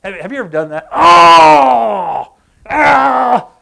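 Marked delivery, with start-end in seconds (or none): 0.9-2.3 loud, high wail of anguish; gnashing-teeth cry. Shown as a demonstration. Preacher asking if you've ever done that, loud anguished wail. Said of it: "that idea of gnashing of teeth". 2.7-3.5 short, loud, high groan; gnashing-teeth cry. Shown as a demonstration. Preacher demonstrating to the congregation, loud frustrated cry. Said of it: "that idea of gnashing of teeth".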